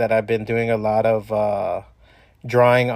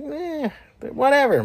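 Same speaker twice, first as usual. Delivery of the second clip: dismissive. Retold or imitated. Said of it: "I'm like".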